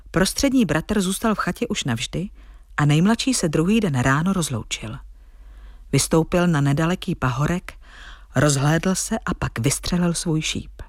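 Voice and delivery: plain reading voice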